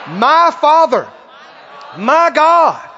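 rousing declaration. Real, not acted. Preacher getting the congregation to say it, rousing shout. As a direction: proclaim it in short, fervent bursts.

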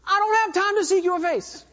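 high, fast, panicked demands; mock panicked believer begging for answers; frantic impatient pleading. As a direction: rattle off needs in a high rush.

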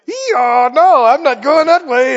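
comic donkey digging its heels in; balking donkey. Acted. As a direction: balk loudly like a startled donkey. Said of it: "That donkey saw that big angel ... and she said".

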